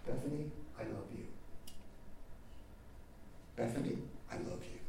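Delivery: softly, a mother soothing her raging daughter; hushed, gentle, almost whispered the second time; tender repeated love. Retold or imitated. Mother calming her furious daughter, soft and tender. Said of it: "she said".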